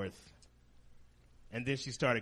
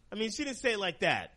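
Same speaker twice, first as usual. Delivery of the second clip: normal talking voice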